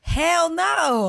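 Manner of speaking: high, loud, flat refusal; emphatic hell no. Real, not acted. Answering her friend's question about quitting, loud and emphatic. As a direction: shoot the idea down hard.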